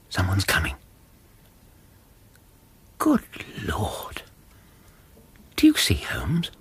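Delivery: startled whisper, rising on the question. Acted. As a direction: hiss it in astonishment. Startled by figure at door.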